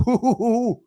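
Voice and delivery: high wavering "hoo-hoo-hoo"; delighted ooh. Real, not acted. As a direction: coo high over delicious food. Relishing the schnitzel.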